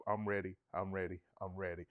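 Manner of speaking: lightly voiced cartoon sponge; SpongeBob. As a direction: chirp I'm ready cartoon-style. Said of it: "Sound like SpongeBob".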